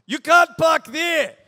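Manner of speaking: a curt German driver's warning, sharply loud; German accent. Retold or imitated. German driver through his window, scolding in a German accent. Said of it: "with a German accent".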